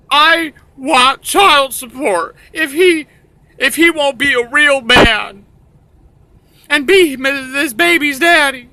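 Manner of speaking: loud, high falsetto mock woman; demanding mother. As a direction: plead shrilly for what is owed. Voicing the mother demanding support.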